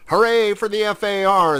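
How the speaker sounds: high, loud rallying hurrah; shouted cheer. A narrator's reading. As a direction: cheer like a wild crew of mice. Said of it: "they shouted".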